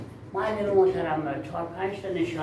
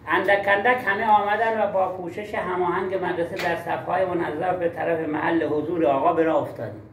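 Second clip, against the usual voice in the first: reading without pauses. Shown as a demonstration. Teacher demonstrates a reading disorder to colleagues, no pauses. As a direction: run the words together in one unbroken stream.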